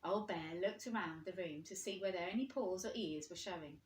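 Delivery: ordinary delivery, nothing marked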